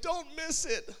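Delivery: high, hushed plea from the stands; nervous parent plea. Retold or imitated. Parent in the stands willing the free throw in, tense hushed plea. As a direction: beg it under your breath from the bleachers.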